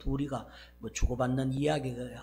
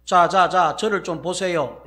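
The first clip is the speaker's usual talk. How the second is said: loud "자, 자, 자" to get everyone's attention; calling out for attention; 10 dB louder than usual. Retold or imitated. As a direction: rap for attention, then ask them to look. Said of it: "이렇게 이제 설명해 가기 위해서". (크게 주목을 부르듯)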